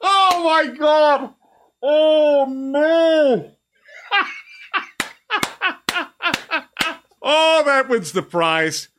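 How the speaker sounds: high, slow laughing oh my God; laughing disbelief. Real, not acted. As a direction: gasp it out through laughter.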